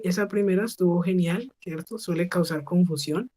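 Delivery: ordinary delivery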